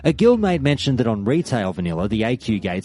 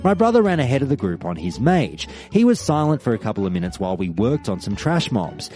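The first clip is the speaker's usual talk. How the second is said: ordinary delivery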